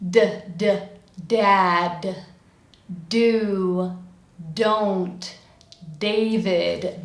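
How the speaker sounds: isolated "d" sounds, then example words; separate, careful words each starting on a clear "d"; demonstrated D. Shown as a demonstration. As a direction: tap out "d", "dad", "do" one by one. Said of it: "same place that I produce the D".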